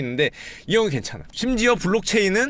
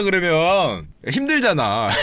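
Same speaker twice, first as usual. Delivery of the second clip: usual speaking voice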